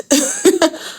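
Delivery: laugh. Real, not acted. Laughs at the cash demand.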